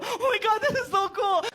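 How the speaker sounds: put-on starstruck kid. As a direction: gush like a star-struck boy meeting his hero. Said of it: "Let's do it, bro".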